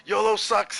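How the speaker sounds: high, loud jeering shout; imitated heckler's yell. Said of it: "and, and yell".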